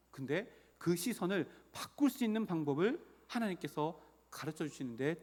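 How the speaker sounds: usual speaking voice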